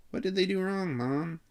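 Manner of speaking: put-on high-pitched child's question; little girl. A narrator's reading. Vicky asking Mom about the boys, high child voice. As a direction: ask it high, like a small girl to her mother. Little sister asks her mother.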